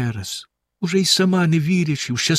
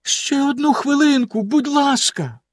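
much higher, begging voice; pleading; 13 semitones higher than usual. A narrator's reading. Wife begs Bluebeard for time, high and pleading. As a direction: plead for one more minute. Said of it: "попросила його дружина".

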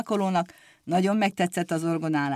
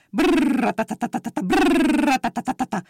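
buzzing brrr motorbike imitation, higher and louder; motorbike roar; 6 semitones higher, 3 dB louder than usual. A narrator's reading. Narrator imitates a motorbike roaring past. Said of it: "rettenetesen berregett".

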